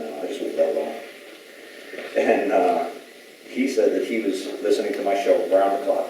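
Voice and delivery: ordinary talk, nothing marked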